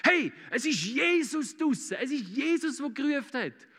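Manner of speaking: high, excited imitation of a disciple; excited cry. John suddenly recognises Jesus from the boat, excited cry. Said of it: "er rennt auf einmal und sagt".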